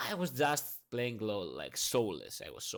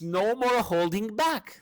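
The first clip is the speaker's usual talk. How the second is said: higher, louder, slow heroic line; hammed-up hero voice, slow and raised; champion quote voice; 9 semitones higher, 8 dB louder, slower than usual. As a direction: declare it like a heroine powering up. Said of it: "And then she says".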